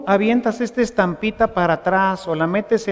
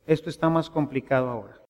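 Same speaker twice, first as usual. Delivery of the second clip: hushed, lower plea to God; weary prayer; 5 semitones lower, 7 dB quieter, faster than usual. Retold or imitated. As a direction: lower and softer, a tired aside to God. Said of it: "Y digo".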